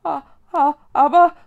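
loud, high startled stutter; stammering. A narrator's reading. Girl sees ghosts overhead, stammering. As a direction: stumble over the first word. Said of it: "stotterte Mila".

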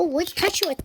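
ordinary talk, nothing marked

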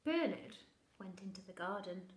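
plain reading voice

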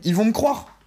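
ordinary talk

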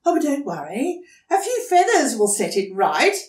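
light, fast lady voice; emu voice. Acted. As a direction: reassure sweetly. Emu voice continues.

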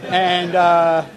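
ordinary talk, nothing marked

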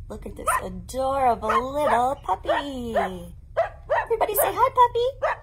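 high, loud delight over a puppy; excited kid voice. Acted. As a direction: squeal it for toddlers. Presenting puppy to small children.

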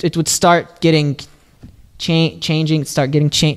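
ordinary delivery

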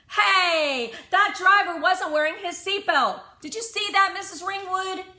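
put-on high, loud, outraged kid; eager young rule-keeper calling out; indignant child tattling. A narrator's reading. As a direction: tattle at full volume like a rule-obsessed kid.

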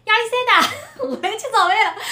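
high, loud Shanghainese call of the name. Retold or imitated. A landlord calls his Italian lodger down to breakfast in Shanghainese. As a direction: call upstairs in old-style Shanghainese, giggling. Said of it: "这种叫法实在是太上海了".

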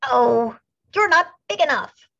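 high, loud dismissive brush-off; scornful ladybug. A narrator's reading. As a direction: wave them off with contempt. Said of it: "said the grouchy ladybug".

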